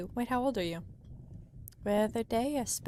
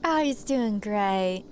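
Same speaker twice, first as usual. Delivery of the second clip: ordinary talk, nothing marked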